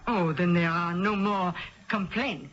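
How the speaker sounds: put-on a Midwestern lady faking a French accent; thick put-on French accent, a bit overdone; phony French accent. Perfume rep posing as French, phony French accent. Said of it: "It was a phony French accent".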